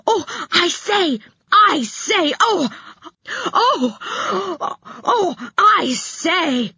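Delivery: high, breathless "oh I say" cries; excited shout. Acted. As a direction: squeal it out like a thrilled boy. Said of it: "he shouted".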